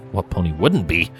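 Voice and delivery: ordinary delivery, nothing marked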